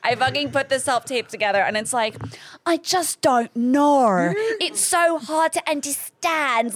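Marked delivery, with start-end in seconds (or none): 2.7-6.7 slow, high, overdone British; bad British accent. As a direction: drag out a hammed-up British accent. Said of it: "My British accent is, is very bad".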